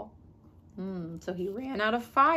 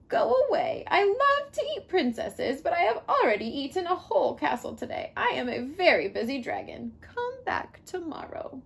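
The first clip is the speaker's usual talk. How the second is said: grumpy dragon; 6 semitones higher than usual. Acted. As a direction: brush her off like a bored dragon. Dragon voice in read story.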